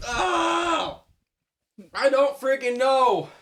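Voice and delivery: drawn-out exasperated yell; frustrated shout. Real, not acted. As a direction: cry out in frustration. Frustrated outburst over quiz.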